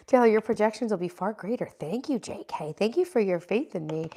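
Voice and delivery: ordinary delivery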